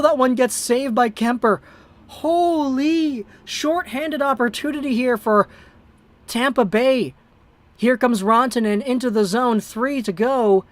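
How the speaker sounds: hockey call at a broadcaster's pace, rising with the action; amateur broadcaster calling a tight third period; live play-by-play. Acted. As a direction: call the game like a TV announcer. Streamer calls the game live.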